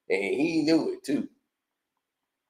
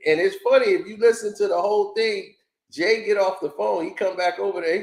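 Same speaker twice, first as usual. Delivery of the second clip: ordinary delivery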